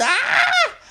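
high shriek breaking into a laugh; shocked shriek. Acted. Mother sees Julie's makeup and lets out a high shriek. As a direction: squeal in mock horror. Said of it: "her mother looked at her and yelled".

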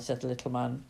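ordinary delivery, nothing marked

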